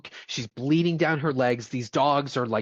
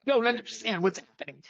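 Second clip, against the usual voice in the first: high, baffled mimicry; mock baffled voice; 7 semitones higher than usual. Host imitates the confused wife at the birth, mock baffled voice. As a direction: play the clueless character, pitched up.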